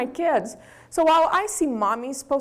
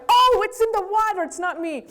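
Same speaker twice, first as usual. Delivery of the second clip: mock teary excuse; 8 semitones higher than usual. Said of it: "I'm like".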